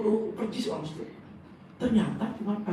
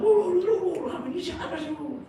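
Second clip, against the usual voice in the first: high, loud mock outburst; angry scolding; 9 dB louder than usual. Angry relative scolds the noisy crowd, hammed-up anger. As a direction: act out someone telling people off. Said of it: "Lagi marah-marah".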